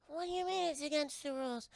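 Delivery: high-pitched alien protest; little monsters. Acted. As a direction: question it in a small squeaky creature voice. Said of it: "And you're like".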